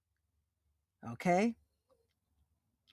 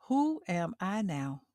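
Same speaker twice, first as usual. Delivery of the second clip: ordinary talk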